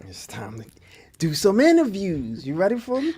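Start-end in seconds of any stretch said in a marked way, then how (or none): 0.1-0.7 unvoiced stage whisper. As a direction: whisper it like a secret. Host teasing the interview segment.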